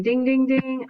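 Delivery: teacher dinging a pretend bell at a turnaround word; playful bell ding. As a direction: ring it out like a quiz-show bell.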